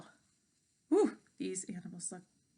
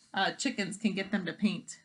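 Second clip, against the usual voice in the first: ordinary delivery, nothing marked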